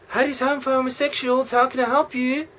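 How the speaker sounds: high, sing-song camp phone voice; camp receptionist. Acted. Caller's line answered by a camp receptionist voice.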